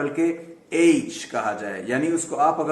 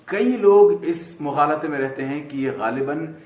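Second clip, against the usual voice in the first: usual speaking voice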